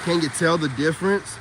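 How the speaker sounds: ordinary talk, nothing marked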